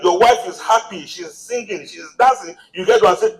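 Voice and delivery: normal talking voice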